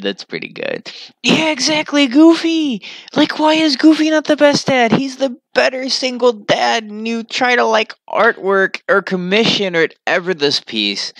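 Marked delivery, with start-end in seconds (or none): 0.0-0.9 quiet low echo; quiet low repeat. Shown as a demonstration. 1.2-9.8 high and louder, thrilled; high excited fan gush. Real, not acted. Excited reaction to comments.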